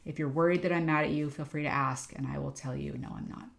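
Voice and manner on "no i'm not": soft breathy whisper, barely there; whispered reply. Retold or imitated. Telling a worried volunteer she is not mad, whispering. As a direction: drop to a whisper for the answer.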